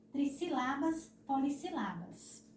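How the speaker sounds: Trissilabas, polissílabas stressed tri-si-LA-bas, po-li-si-LA-bas; wrong stress on LA. Shown as a demonstration. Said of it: "Caso vocês não coloquem acento agudo". (Trissilabas, polissílabas com a tônica no LA)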